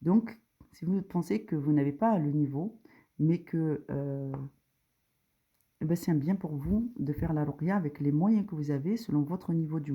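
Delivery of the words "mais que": normal talking voice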